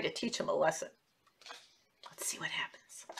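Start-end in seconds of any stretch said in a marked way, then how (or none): 2.1-3.1 hushed whisper of suspense. Acted. Teacher whispering to children before the story goes on. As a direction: lean in and whisper to the kids.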